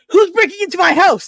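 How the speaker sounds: loud, high cry of a woken mom; mock alarmed shout; startled mom. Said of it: "she sits up in bed and goes".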